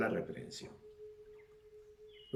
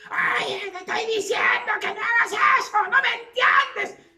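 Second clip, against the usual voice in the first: shrieking mock shout, far higher and louder; mother yelling like mad; 7 dB louder than usual. A mother yelling at her child, in a shrill mock shriek. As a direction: scream it like a frantic mother losing it. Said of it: "la madre gritando como loca".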